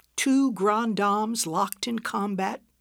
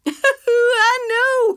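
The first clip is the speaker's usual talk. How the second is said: much higher and louder through laughter; laughing; 10 dB louder than usual. Acted. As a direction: squeal it out mid-laugh. Both laughing helplessly.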